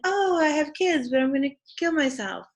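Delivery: high, loud, fast mock-breezy; flippant Jocasta. Teacher voicing Jocasta's breezy suicide, high and rushed. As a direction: toss it off like nothing.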